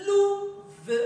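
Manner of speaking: "louve" as high, loud, drawn-out "lou" plus separate "ve"; syllable by syllable. Shown as a demonstration. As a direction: dictate "louve" in two distinct beats. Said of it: "on le lit syllabe par syllabe".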